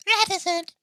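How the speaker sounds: very high, loud mimicry of "Reticent"; imitating her pronunciation. Host copies the way she says it, squeaky loud imitation. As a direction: squeal "Reticent" in a put-on female voice. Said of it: "I like the way she says it".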